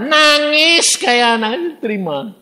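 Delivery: high, loud, drawn-out mock wail; comic exclamation. Preacher describes the businessman crying like a child, mock wail.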